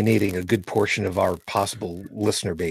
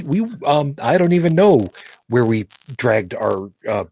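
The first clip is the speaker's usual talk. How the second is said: ordinary talk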